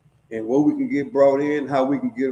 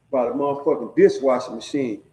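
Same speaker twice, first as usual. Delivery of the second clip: usual speaking voice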